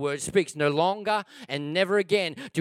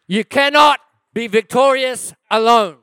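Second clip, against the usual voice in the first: high, loud revival sermon; impassioned preacher in full cry; full-force preaching; 7 semitones higher, 10 dB louder than usual. Real, not acted. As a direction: preach it at a driving shout.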